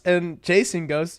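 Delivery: usual speaking voice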